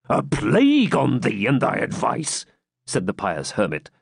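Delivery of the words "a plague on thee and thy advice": slow, gruff friar's curse; grumpy friar voice. A narrator's reading. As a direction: grumble it at the knight. Hermit retorts.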